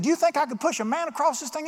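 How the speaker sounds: high-pitched showman's challenge; animated acted question; calling to a spectator.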